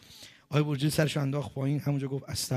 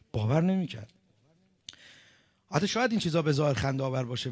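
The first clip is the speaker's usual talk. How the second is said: ordinary talk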